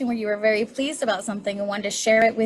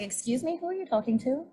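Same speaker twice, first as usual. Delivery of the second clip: softer, higher-pitched affronted reply; mock offended; 3 semitones higher, 6 dB quieter than usual. Offended reply to rough-voiced compliment.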